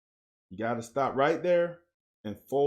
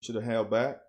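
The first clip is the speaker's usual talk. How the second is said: ordinary talk, nothing marked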